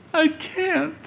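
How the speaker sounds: high, pitiful whimper of a stuck dead man. Acted. Lazarus whimpering back at Jesus from the tomb. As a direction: whine it like a helpless mummy. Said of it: "In a whimpering voice".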